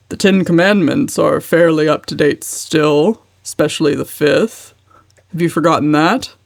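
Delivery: lightly voiced heavy, stern uncle. A narrator's reading. Uncle lecturing his niece, a hint of heaviness. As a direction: lecture her weightily. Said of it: "said Uncle James heavily".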